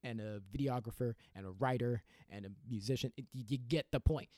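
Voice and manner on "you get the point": usual speaking voice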